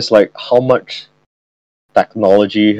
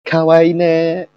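high slow cutesy anime voice; excited anime fan; 7 semitones higher, slower than usual. Pretend. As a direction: coo it like an anime girl. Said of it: "me acting cute".

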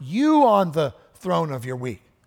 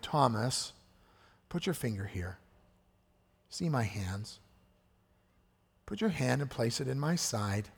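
loving Jesus; 3 semitones lower, 8 dB quieter than usual. Retold or imitated. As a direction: gently coax Thomas, voice dropped low and soft. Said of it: "Jesus so lovingly comes to Thomas".

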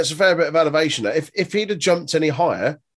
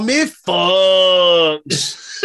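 very slow, loud, higher mock-sultry voice; odd put-on voice; 5 semitones higher, 5 dB louder, much slower than usual. Co-host voicing Alicia Fox in a hammed-up, sultry voice. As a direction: drag it out like a vamping diva. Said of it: "what happened to my voice at the start there".

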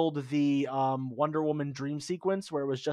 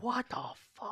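high, hushed incredulity; re-enacted disbelief; 7 semitones higher, 10 dB quieter, slower than usual. Fan retelling his reaction to co-host, hushed disbelief. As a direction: let it out softly, pitched up.

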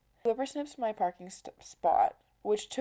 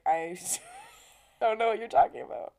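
laughing in disbelief; 3 semitones higher, 4 dB louder than usual. Retold or imitated. Baffled reply to Wolverine claim.

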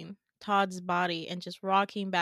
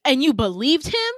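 loud, high outraged question; incredulous outburst; 6 semitones higher, 11 dB louder than usual. Retold or imitated. Caden confronting Peach over trusting Richter, incredulous. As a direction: throw it out in acted disbelief. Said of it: "And Caden's like".

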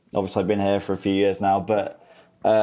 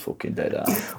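Grimsby accent whisper; 11 semitones higher, 6 dB quieter than usual. Shown as a demonstration. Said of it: "can you do a, a Grimsby accent?".

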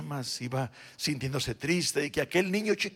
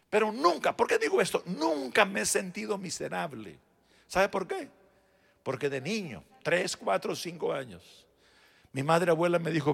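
ordinary delivery

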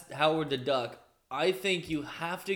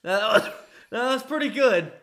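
director; 5 semitones higher, 4 dB louder than usual. Acted. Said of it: "the director's like".

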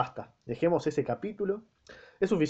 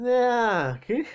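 loud high shocked what; loud high startled outcry; 10 semitones higher, 5 dB louder than usual. Real, not acted. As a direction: react in disbelief. Shocked by the line.

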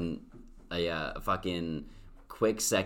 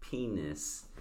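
soft punchline; 9 dB quieter than usual. Retold or imitated. Repeats sugar cube video punchline.

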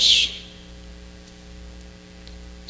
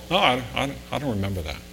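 modest denial; 2 semitones lower, 8 dB quieter, much faster than usual. Retold or imitated. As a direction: mumble the denial away quietly. Donor modestly denies giving clothes.

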